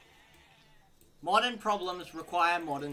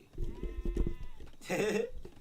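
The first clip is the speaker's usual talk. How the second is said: high-pitched laugh. Real, not acted. Laughs after media joke.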